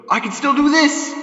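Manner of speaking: high, loud magical cry; triumphant shout. Acted. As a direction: announce the magic with a big shout. Santa shows off his magic.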